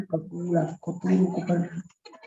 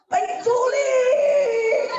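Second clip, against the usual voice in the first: very high, slow drawn-out shout; alarmed cry; much slower than usual. Pretend. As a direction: cry out the alarm like a frightened mother.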